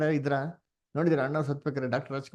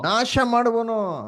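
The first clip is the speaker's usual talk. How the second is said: high, loud, slow repeat of the verse; preacher echoing scripture with force; emphatic preaching; 8 semitones higher, 7 dB louder, much slower than usual. Real, not acted.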